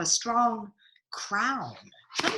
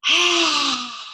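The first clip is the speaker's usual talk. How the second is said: monster roar; 8 semitones higher, 8 dB louder than usual. Acted. Sea monster roar pose.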